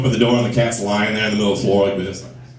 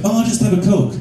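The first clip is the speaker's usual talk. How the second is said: higher, light female voice; girlfriend; 9 semitones higher than usual. Acted. Girlfriend orders from waiter, light high voice. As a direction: mimic a girlfriend ordering, pitched up. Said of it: "And she'd be like".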